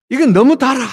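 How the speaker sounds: high, loud mock grumble; complaining. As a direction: imitate a picky eater protesting. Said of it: "이런 사람도 있고".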